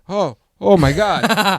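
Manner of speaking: slow, high, loud shocked gasp of words; old man realizing his gas is spilling. Retold or imitated. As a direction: drag it out in disbelief, imitating the old man. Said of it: "he just turns and he goes".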